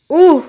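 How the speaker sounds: short, high, loud startled cry; sudden loud exclamation of alarm; startled "ooh". Acted. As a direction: yelp "ooh" as the bike tips. Startled cry while falling off bicycle.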